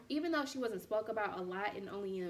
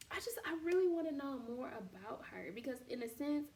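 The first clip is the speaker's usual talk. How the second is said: whispering; 3 semitones higher, 5 dB quieter than usual. Real, not acted. Confiding she relates to Vashti.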